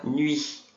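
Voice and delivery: "Nuit." with lips rounded into a pout, U and I both sounded; teacher drills "Nuit." with rounded lips; pursed lips. Shown as a demonstration. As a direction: pout on "Nuit.", then glide to the I. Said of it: "Tem que fazer o biquinho".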